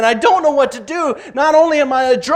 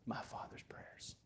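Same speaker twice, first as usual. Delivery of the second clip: whisper; 32 dB quieter than usual. Real, not acted. Preacher closing his story to the congregation, whispered. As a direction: trail off to a slow whisper. Testimony ending in hush.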